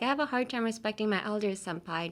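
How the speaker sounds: quick smooth run through the line; said smoothly. Shown as a demonstration. Teacher models the first sentence, smooth and quick. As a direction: glide through without stopping. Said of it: "Just say it, like, you know, smoothly".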